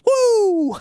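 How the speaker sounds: long, very high "woo"; imitated Vader whoop. Second wrestler leans in on the promo, high mock "woo". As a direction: mimic a wrestler copying his partner's whoop. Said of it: "Vader leans in and goes".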